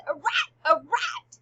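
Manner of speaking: high, loud acted cry; mock cry of alarm. Queen quotes Hamlet at the curtain, crying out in alarm. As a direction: cry out a dramatic warning. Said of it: "and cries".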